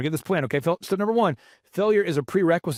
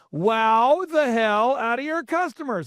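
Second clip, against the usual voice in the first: slow, grand theatrical impersonation; Michael Levine; 3 semitones higher, slower than usual. Retold or imitated. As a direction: stretch every word for effect. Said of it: "And he's so theatrical about it".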